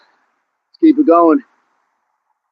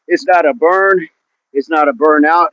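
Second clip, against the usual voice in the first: ordinary talk, nothing marked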